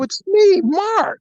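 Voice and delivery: high, loud name call; high announcing call. Retold or imitated. Undercover buyer announcing himself at the door, high and loud. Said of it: "I go".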